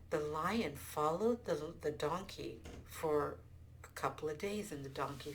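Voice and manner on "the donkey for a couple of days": plain reading voice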